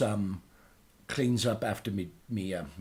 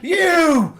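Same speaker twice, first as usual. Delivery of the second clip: high, loud furious rant; hammed-up angry yell at the screen; ranting at the telly; 12 semitones higher, 13 dB louder than usual. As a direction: shout at the television in mock outrage. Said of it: "I'm like".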